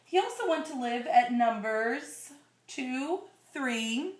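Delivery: put-on slow, gentle read-aloud to a child; storybook reading voice; story narration. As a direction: read it like a bedtime picture book. Reading picture book to child.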